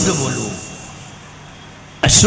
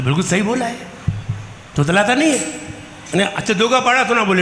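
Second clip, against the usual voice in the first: normal talking voice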